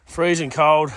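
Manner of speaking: out of breath; puffing between words; breathing heavy. Real, not acted. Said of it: "Excuse me, breathing heavy".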